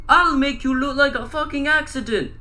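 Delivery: high, loud threatening yell; Cat in the Hat scream. A narrator's reading. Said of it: "the cat screamed again".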